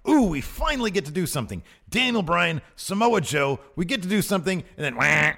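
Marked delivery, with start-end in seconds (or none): 0.1-4.6 higher, playful glee for two wrestlers; mock excitement. Best friends finally get to wrestle, mock excited. 4.9-5.3 abrupt "wah" of entrance music; imitated music blare. Cass's music interrupts the wrestlers, imitated blare.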